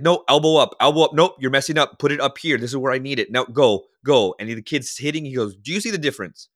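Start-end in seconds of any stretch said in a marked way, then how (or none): 0.0-4.3 loud, higher, barking instructions; forceful coach voice. Retold or imitated. Baseball coach drilling a kid on his swing, loud and forceful. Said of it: "it's just this forceful voice". 5.5-6.5 put-on higher, pushing the kid to notice; a coach fired up about progress; motivational coach. Coach asking his hitter to see the difference, upbeat and pressing. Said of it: "but it's a motivational voice".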